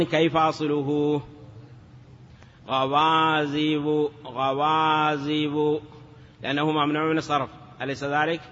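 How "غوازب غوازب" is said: very slow "غوازب", long vowels held; teacher slowly modelling the underlying form; drawn-out citation. Shown as a demonstration. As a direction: stretch each syllable of "غوازب".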